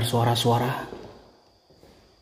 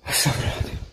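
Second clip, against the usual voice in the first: frightened whispered prayer; 5 dB quieter, faster than usual. Real, not acted. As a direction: breathe it out, shaken. Frightened after bang.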